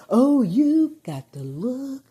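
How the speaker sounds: hammed-up slow compliment; mock flattering gush. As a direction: gush it like a fawning influencer. Mimicking YouTubers flattering her.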